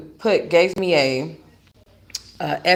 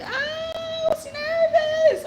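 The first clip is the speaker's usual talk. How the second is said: drawn out squeak; much slower than usual. Retold or imitated. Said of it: "I was just like".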